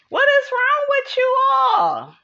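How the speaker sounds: high, loud, fed-up yell; exasperated shout. Real, not acted. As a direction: shout it in disbelief. Exasperated rant outburst.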